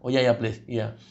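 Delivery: low, soft, submissive yes; low, soft, meek nodding along; meek low agreement. Acted. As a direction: drop low and give in. Tifa meekly agreeing.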